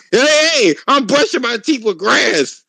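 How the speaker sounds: high-pitched mock announcement to the dentist; mock proud announcement.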